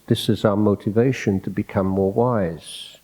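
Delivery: ordinary delivery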